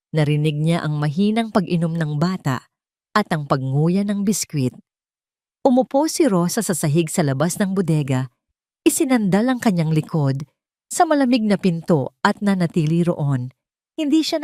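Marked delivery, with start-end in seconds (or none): none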